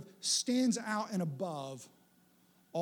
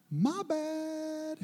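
high, drawn-out comic apology; mock sheepish; 9 semitones higher than usual. Person realising they misunderstood after losing their temper, mock sheepish. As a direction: stretch it out high in playful embarrassment.

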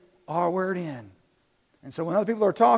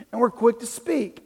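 ordinary delivery

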